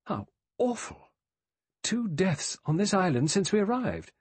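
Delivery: high, shocked exclamation; appalled outcry from Vera; exclaimed. A narrator's reading. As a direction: exclaim it, pitch jumping well up. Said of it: "Vera exclaimed".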